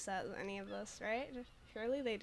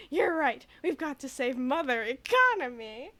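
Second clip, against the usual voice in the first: louder, hammed-up mock agreement; 2 semitones higher, 8 dB louder than usual. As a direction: play along in fake earnest with laughter. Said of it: "He's so funny".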